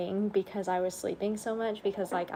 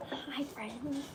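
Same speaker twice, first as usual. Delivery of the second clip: soft whispered hello; quick whispered hi to viewers; 5 semitones higher, 10 dB quieter than usual. Real, not acted. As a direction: breathe the greeting without voice. Greeting softly.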